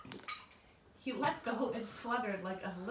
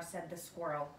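usual speaking voice

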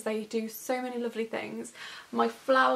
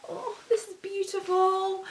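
higher, cooing over it; high delighted coo; 8 semitones higher than usual. Real, not acted. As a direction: gush over how lovely it is. Admiring the jumper.

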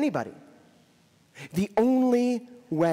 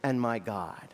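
low, soft and slow, a touch of tears; whisper-close awe, understated; tearful confession; 7 semitones lower, 4 dB quieter, slower than usual. Retold or imitated. Thomas kneeling before Jesus, low, soft and slow in awe. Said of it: "you can hear the tears coming down Thomas's face".

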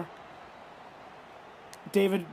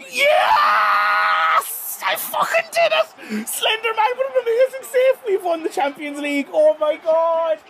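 ecstatic scream; 13 semitones higher, 5 dB louder than usual. Real, not acted. Winning the Champions League.

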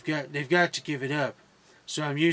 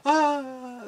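mock gasp, much higher; hammed-up fake surprise; pretend surprise; 9 semitones higher than usual. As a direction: ham up a fake shocked gasp. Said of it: "my pretend surprise face".

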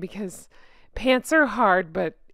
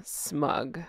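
smug caption; 8 semitones lower, 10 dB quieter than usual. Pretend. One-word smug caption.

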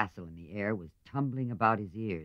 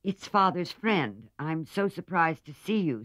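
stammering; 7 semitones higher, 6 dB louder than usual. A narrator's reading. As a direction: fumble the words, caught out. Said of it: "stammered Meg".

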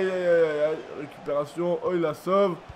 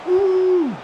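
high excited whoop; 13 semitones higher, 7 dB louder than usual. Real, not acted. Excited at Mané's run.

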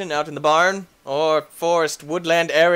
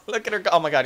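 ordinary delivery

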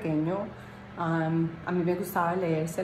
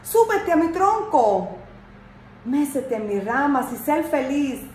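much higher, louder, overjoyed tree; joyful talking tree; 7 semitones higher, 3 dB louder than usual. A narrator's reading. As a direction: shake with joy as the tree calls. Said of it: "el árbol se agitó alegremente y le dijo". (árbol que habla, muy alegre y agudo)

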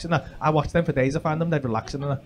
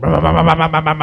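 louder revving engine impression, right outside; loud mock scrambler engine; 2 semitones lower, 7 dB louder, faster than usual. Heard in the dream outside his bedroom, loud engine imitation. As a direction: rev it up loud like bikes at the window. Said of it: "I just heard".

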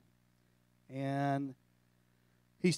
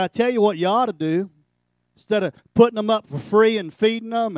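loud, higher, contemptuous advice; loud scornful pastor; 6 semitones higher, 12 dB louder than usual. Retold or imitated. Retelling a pastor's scorn, loud and contemptuous. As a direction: bellow it like a scornful preacher.

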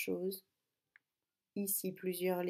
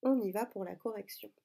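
ordinary talk, nothing marked

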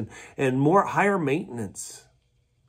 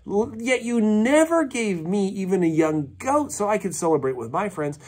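high, indignant complaining voice; resentful older son; 7 semitones higher than usual. Retold or imitated. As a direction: whine it out like a son who feels cheated. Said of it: "But he answered his father".

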